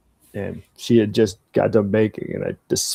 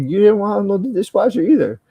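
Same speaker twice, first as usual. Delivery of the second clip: high-pitched, loud mock comeback to wife; mocking retort; 11 semitones higher, 8 dB louder, faster than usual. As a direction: throw it back at her in a high, loud mocking voice. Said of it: "I was like".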